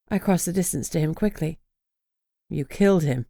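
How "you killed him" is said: said dully. A narrator's reading. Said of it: "he said dully".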